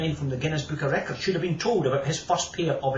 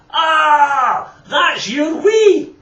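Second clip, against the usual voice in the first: high, loud drawn-out squeal; 12 dB louder, much slower than usual. Acted. Alison squealing at George's jar, high and loud. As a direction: shriek it in disgust. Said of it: "squealed Alison".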